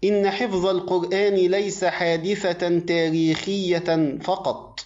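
ordinary delivery

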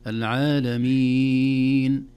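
loud, very slow العالمين, final ee held six beats; six-count madd. Shown as a demonstration. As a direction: hold the last vowel of العالمين at full length. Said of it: "اثنين وأربع وست حركات".

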